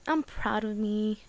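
a soft, slow sigh, then the words through tears; tearful sigh. Real, not acted. Telling her viewers, tearful and soft, sighing. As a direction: sigh it out softly, still teary. Tearful self-praise after crying.

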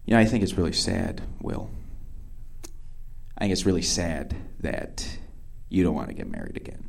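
low, hushed and grave, with long pauses; very serious. Retold or imitated. Date tells him it's really sad, suddenly very serious. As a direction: drop it down soft and solemn. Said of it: "suddenly gets very serious".

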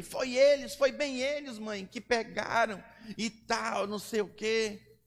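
put-on a boy shifting blame, mildly pleading; a hint of a lying child. Son blaming his brothers to his mother, lightly whiny child voice.

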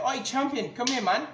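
mock calling the waiter over, loud and matey. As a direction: shout across the bar for the waiter. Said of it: "We just say".

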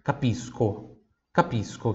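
"Capisco" as ka-PEES-ko, softly modelled; soft teacher's model of "Capisco", no sh; hard SC. Shown as a demonstration. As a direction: repeat "Capisco" with a clear S then K. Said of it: "não tem som de X".

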